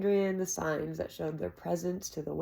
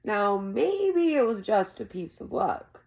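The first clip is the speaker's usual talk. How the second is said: lightly voiced old village storyteller, spinning a tale; old storyteller Cobb; 4 semitones higher, 5 dB louder, slower than usual. A narrator's reading. Old Cobb telling the boy a legend, lightly voiced storyteller. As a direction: lean into the legend like an old man at the inn.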